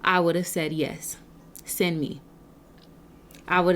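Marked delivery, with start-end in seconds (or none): 0.7-2.2 low, very soft acceptance; soft solemn yes. Acted. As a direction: answer God quietly, voice dropped low. Solemnly answering God's call.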